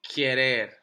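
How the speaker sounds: querer said clearly for students to echo; model word to repeat. Shown as a demonstration. Teacher to students: models querer for repetition. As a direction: give querer slowly as a model. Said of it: "So repiten".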